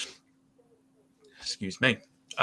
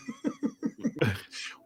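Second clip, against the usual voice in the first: real laugh squeaking up very high; 6 dB quieter than usual. Real, not acted. Laughing with the co-host at his diseased-chicken laugh.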